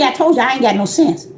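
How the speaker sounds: ordinary talk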